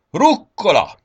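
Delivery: high, very loud reveal; booming exclamation; triumphant shout. A narrator's reading. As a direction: cry it out like a grand announcement. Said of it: "kiáltotta Mikulás".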